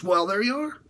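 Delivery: usual speaking voice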